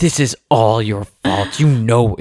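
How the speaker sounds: slow, lightly acted angry child; Avery, mad. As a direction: drag out a little girl's accusation. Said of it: "she was so mad".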